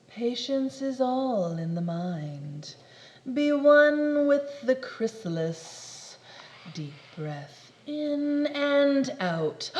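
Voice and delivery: slow calm breaths; slow mock-zen; mock meditation. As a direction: settle into it.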